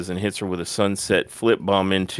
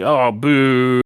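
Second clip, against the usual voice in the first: drawn-out, louder, higher mock boo; imitated crowd booing; 5 semitones higher, 7 dB louder, much slower than usual. Crowd reacting to the refused handshake, mock booing. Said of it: "and everyone's like".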